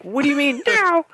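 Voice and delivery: squeaky high outraged question; high disbelief. Retold or imitated. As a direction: voice jumps high in shock. Retold disbelieving reply.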